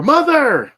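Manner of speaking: "Mother!" exclaimed high and loud; exclamation mark. Real, not acted. Host announcing the beaten film's title, "Mother!" exclaimed. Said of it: "say it like that with the exclamation mark".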